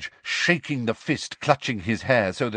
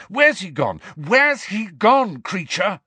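loud, high, insistent questioning; urgent demand; 10 semitones higher, 5 dB louder, slower than usual. A narrator's reading. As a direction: press harder on the repeat, slowing down. Harry urgently presses the elf.

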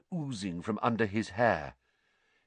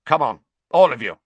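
barked order; 3 semitones higher, 9 dB louder, faster than usual. Acted. Snape barking order.

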